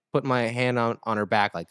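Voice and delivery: ordinary talk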